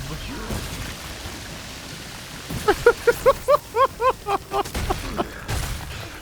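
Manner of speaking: long high laugh; laughing fit. Real, not acted. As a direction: break into a drawn-out cackle of amusement. Laughs at the one punching.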